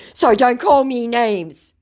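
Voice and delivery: slow, hammed-up hurt protest; upset, triggered. Scammer protesting at being called names, mock upset. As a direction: act the scammer stung and sulking. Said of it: "completely triggered and upset now".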